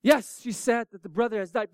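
normal talking voice

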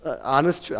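ordinary talk, nothing marked

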